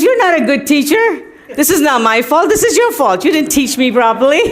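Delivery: high, teasing mock-indignant voice, laughing at the end; mock accusing guru. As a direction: play the guru pretending it's all her fault. Guru playfully blaming the teacher.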